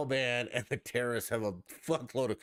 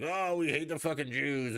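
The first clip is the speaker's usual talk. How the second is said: mocking Ilhan Omar; 6 semitones higher than usual. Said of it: "Ilhan Omar up there like".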